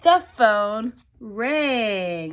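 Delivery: drawn-out suspenseful telling; slow dramatic. A narrator's reading. Storyteller to class, very slow suspense. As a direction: stretch it out for suspense.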